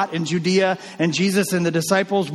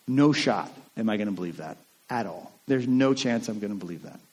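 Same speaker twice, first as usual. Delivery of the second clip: obstinate; 6 semitones lower, 5 dB quieter than usual. Acted. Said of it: "He's obstinate in his response".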